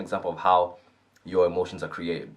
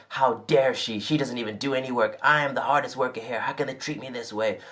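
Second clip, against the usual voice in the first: higher and higher, building into a hammed-up outburst; acted-out fury climbing up to a squeaky top; mock rising rage; 5 semitones higher than usual. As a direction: play the inner rant until it boils over in a mock shriek. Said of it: "You start playing".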